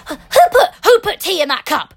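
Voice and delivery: high, loud outraged yell with a crack; shrieking at whoever used his cup; losing it. Retold or imitated. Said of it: "like, loses it".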